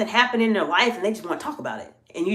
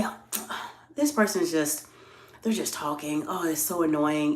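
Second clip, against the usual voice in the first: quieter, brushing-off annoyed mutter; dismissive grumble; 4 semitones lower, 8 dB quieter than usual. Retold or imitated. Presenter voicing a dismissive leader in a meeting, quiet and annoyed. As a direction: wave the talker off under your breath. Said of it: "If you just let it go and say".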